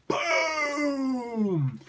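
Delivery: cannon blast. Retold or imitated. Megatron fires fusion cannon.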